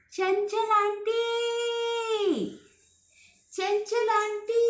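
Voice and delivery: long, high sung-out call across the hills; stretched calling shout; calling out loud. Acted. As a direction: call out loudly to the far-off aunt. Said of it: "किस तरह से, आप करके बताओ।".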